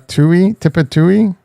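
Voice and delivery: Tip a Tui as tip-uh-TOO-ee; silly mispronunciation. Shown as a demonstration. Streamer trying yet another option, Tip a Tui as tip-uh-TOO-ee. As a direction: slowly sound out Tip a Tui, rising at the end. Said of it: "how do you say it".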